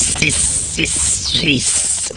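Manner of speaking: soft voiceless sibilant hiss; snake hissing. Pretend. Man introducing his pet snake, soft hissing. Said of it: "it's my snake".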